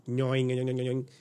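imitated springy "nyoing"; cartoon rising noise. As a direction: make a repeated cartoon boing.

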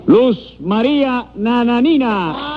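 high, slow drawn-out name call; high-pitched court crier; roll call. Acted. Court clerk calling the roll.

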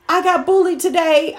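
son's voice. Retold or imitated. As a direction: play the son reporting sadly. Son telling, imitated.

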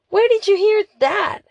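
high, loud demand. Acted. Mia demands to know who told Lily, loudly. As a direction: fire the question back in shock. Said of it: "I demanded".

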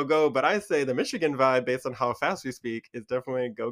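ordinary delivery

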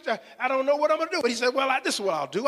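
ordinary talk

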